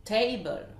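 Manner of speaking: TA stressed in "Table", second syllable falls; first-syllable stress. Shown as a demonstration. Teacher models a common word, stress on TA in "Table". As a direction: hit the start: TA-ble. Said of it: "l'accentuation sur la première syllabe".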